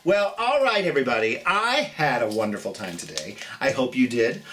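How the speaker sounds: ordinary delivery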